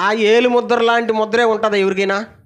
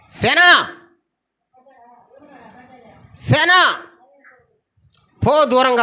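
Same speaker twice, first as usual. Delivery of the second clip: Jesus commanding; 3 semitones higher than usual. Retold or imitated. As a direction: cast the demons out with authority. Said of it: "అన్నాడు".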